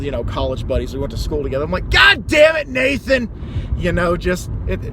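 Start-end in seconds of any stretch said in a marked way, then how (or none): 1.9-3.3 high, loud exasperated curse; cursing the seller. Retold or imitated. Cursing the seller's name for spoiling Christmas, loud and high. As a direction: yell his name like an old buddy. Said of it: "just cursing the guy's name".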